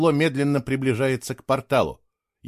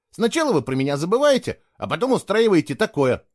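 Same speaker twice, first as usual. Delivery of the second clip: put-on higher-pitched, aggrieved boy; disgruntled complaint; 7 semitones higher than usual. A narrator's reading. Boy complaining to the friends who forgot him, disgruntled. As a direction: grumble at being wronged. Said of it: "недовольно пробурчал Фло".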